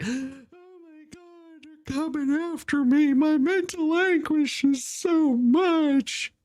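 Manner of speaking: high, slow, whining put-on voice; mock whiny victim. Mocking the no-shows who cry about being sued, in a high whiny mock voice. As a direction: mimic someone wailing about their mental anguish, high and drawn out. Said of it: "They're just like".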